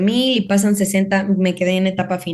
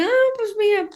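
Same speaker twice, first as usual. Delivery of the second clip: perky retold self; 12 semitones higher than usual. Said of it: "Y yo".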